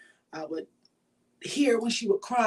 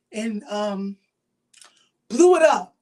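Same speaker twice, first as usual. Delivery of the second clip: normal talking voice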